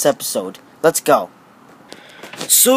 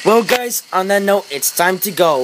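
ordinary talk

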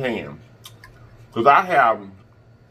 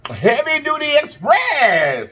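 high, slow, drawn-out announcer call; 14 semitones higher, much slower than usual. Pretend. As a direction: boom out the name like a hype announcer. Announcing channel name with flourish.

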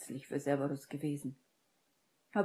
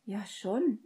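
hesitant, higher-pitched admission; mildly flustered, pitched up; embarrassed stammer; 6 semitones higher than usual. A narrator's reading. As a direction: admit it sheepishly. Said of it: "stotterte Hermine errötend".

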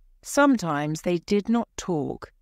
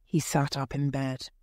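usual speaking voice

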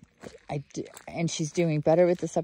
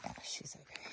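hushed, unvoiced aside; whisper; 20 dB quieter, faster than usual. Real, not acted. Owner whispering while the puppy settles, hushed. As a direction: drop to a whisper so the dog stays calm.

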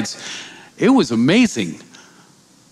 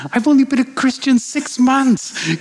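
much higher, desperate pleading to God; panicked plea; 10 semitones higher than usual. Retold or imitated. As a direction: beg God in panic, pitched way up. Same words, now panicked.

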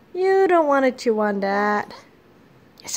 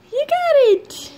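delighted squeal; 13 semitones higher, 3 dB louder than usual. Real, not acted. Delighted praise to baby.

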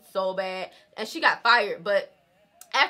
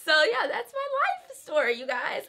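laughing; 7 semitones higher than usual. Real, not acted. Laughs while wrapping up story.

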